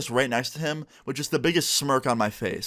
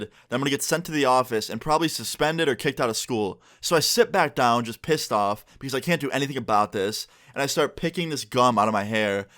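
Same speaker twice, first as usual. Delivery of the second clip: plain reading voice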